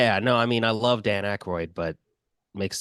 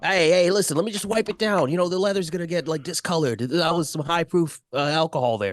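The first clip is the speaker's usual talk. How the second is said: higher-pitched, fussing and persistent; fussy bartender; 8 semitones higher, faster than usual. Acted. Bartender fusses to Kenny about wiping the seat. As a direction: nag him about the leather, pitched up.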